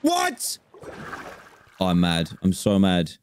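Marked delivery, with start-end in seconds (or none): 0.0-0.6 a sharp, very high yelp of disbelief; shocked what. Real, not acted. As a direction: squeak it out in sudden shock. Shocked by the map.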